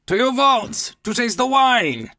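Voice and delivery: high eager demand; eager Fortunato. Acted. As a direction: push to go now like an excited drunk.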